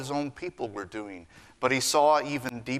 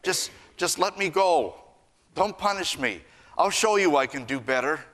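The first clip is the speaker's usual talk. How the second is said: put-on pleading child; 7 semitones higher than usual. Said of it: "the child may say".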